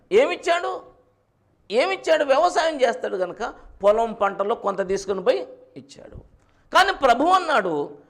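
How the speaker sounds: loud, high preacher's delivery; full-force preaching. Real, not acted. Preacher asks congregation about Cain's offering, full-force preaching.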